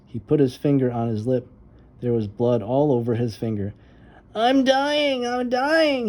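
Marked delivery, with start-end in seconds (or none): 4.3-6.1 high, hammed-up child's panic; crying out in panic. Boy wailing to his sister over his cut lip, hammed-up panic. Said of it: "he cried".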